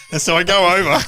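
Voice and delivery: building up the story eagerly; excited. Real, not acted. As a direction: voice lifting with glee. Excited retelling, pitch jump.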